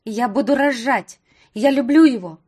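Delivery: loud tearful cry; shouting. Acted. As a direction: scream it out defiantly. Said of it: "послышался крик Кати".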